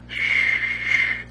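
a gentle rustle through grass; gentle shh. Retold or imitated. Snake crawling in the grass, gentle shh. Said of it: "so gentle".

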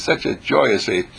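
ordinary talk, nothing marked

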